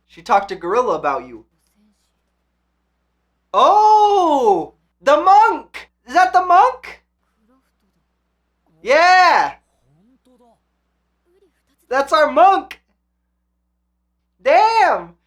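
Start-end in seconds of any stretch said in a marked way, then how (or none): 3.5-15.1 loud, high, thrilled yelling; excited shouting. Real, not acted. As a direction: yell it out in pure delight. Recognizing a character, excited.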